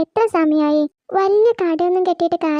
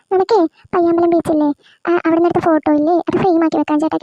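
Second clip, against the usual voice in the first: ordinary delivery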